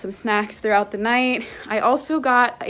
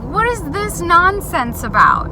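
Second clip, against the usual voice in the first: incredulous; 8 semitones higher than usual. Retold or imitated. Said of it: "I was like".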